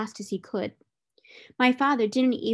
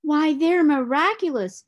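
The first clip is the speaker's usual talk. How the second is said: higher, louder amazed monkey cry; 6 semitones higher, 6 dB louder than usual. A narrator's reading. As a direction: marvel at it like delighted little monkeys. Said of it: "said the six little monkeys".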